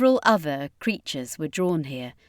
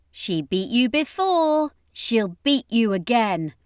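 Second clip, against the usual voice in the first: higher, slow mocking jab; taunting; 4 semitones higher than usual. A narrator's reading. Friaj goading Jakarian about Dion, higher slow taunt. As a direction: rub his defeat in, drawn out. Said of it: "continued to taunt Jakarian".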